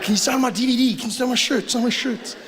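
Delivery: mock fan voice. Comedian imitating a fan begging for an autograph, high pitched. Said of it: "You'll be like".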